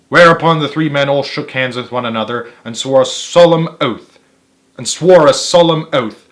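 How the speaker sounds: usual speaking voice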